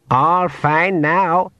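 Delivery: put-on slow, high old pixie reassuring. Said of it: "he said".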